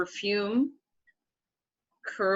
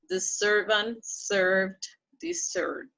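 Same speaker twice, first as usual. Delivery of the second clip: slow corrected reading; slower than usual. Shown as a demonstration. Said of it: "The stress is in the er".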